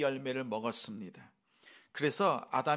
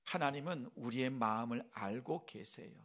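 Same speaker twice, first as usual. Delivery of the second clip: ordinary talk, nothing marked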